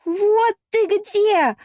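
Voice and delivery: high, loud, drawn-out jealous shriek; девичий вопль. A narrator's reading. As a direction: shriek it in gloating fury at a cheater caught. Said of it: "оглушил меня девичий вопль". (яростный ревнивый вопль)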